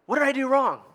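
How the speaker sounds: put-on high, indignant kid voice; mock innocent kid. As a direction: play the wronged son protesting. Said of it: "this mean look like".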